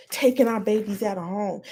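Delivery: ordinary talk, nothing marked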